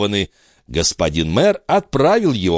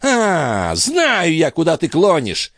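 high, knowing cut-in; high-voiced, scornful interjection; sharp interruption; 7 semitones higher, slower than usual. A narrator's reading. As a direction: break in on him with raised pitch. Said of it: "перебил его аббат".

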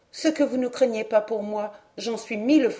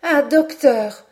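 slow, louder, higher cry of joy; delighted cry; 4 semitones higher, 7 dB louder, slower than usual. A narrator's reading. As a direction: burst out thrilled. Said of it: "s'écria Hélène ravie". (s'écrier, ravie)

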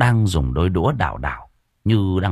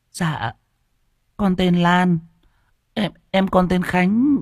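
much higher, shy small voice; timid girl; 11 semitones higher, slower than usual. Acted. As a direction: answer shyly as a little girl. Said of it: "Con bé lý nhí". (bé gái lý nhí, rụt rè)

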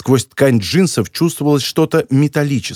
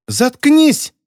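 high-pitched squealed outburst; shrieked; 11 semitones higher than usual. A narrator's reading. Girl lashes out at the scolding editor, shrieking. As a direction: squeal it out in anger. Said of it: "взвизгнула та".

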